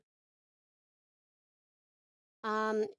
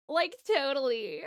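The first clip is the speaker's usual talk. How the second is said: put-on Valley Girl voice; 3 semitones higher than usual. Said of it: "Valley Girl song".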